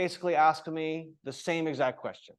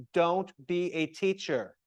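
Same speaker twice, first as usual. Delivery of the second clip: usual speaking voice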